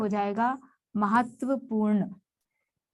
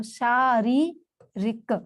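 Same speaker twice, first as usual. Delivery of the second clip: शारीरिक drawn out very slowly, in parts; syllable by syllable; much slower than usual. Shown as a demonstration. Teacher showing spelling by sound, शारीरिक slowly broken up. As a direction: stretch शारीरिक as शा-री-रिक. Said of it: "जैसा बोलेंगे वैसा लिखेंगे".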